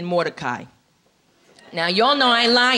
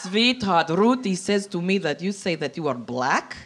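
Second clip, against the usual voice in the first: put-on Hungarian accent; 2 semitones lower, 5 dB quieter than usual. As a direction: play a Hungarian princess, gently correcting in a thick accent.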